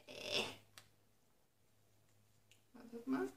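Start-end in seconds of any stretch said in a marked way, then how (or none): none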